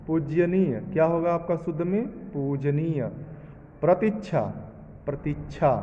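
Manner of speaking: usual speaking voice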